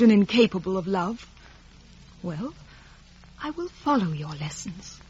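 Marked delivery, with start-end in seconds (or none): 2.2-5.0 cold quiet. Acted. Estella cold reply.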